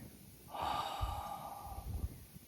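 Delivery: long breathy sigh out through the mouth; demonstrated sigh. Shown as a demonstration. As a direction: let a slow, voiceless exhale go. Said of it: "out through the mouth with a sigh".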